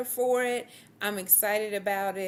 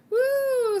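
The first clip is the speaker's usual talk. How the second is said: long, loud, high "woo"; high whoop; 7 dB louder than usual. Real, not acted. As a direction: let out a whoop after a good laugh. Host exhales after shared laughter.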